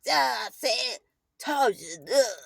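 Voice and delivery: rough, garbled imitation of his grumbling; imitating angry man. Stepfather snatching the remote from a child, mock angry growl.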